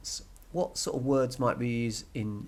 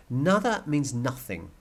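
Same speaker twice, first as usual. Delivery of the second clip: usual speaking voice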